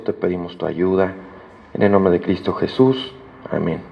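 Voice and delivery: low, reverent spoken prayer; preacher praying before the sermon. Acted. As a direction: pray humbly on behalf of the church. Leads congregation in prayer.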